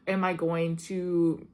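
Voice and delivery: normal talking voice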